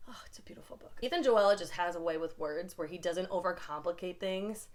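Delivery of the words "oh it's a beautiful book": hushed, moved whisper; whispered awe. Real, not acted. Reviewer praising the author to viewers, hushed whisper. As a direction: drop to a whisper, touched.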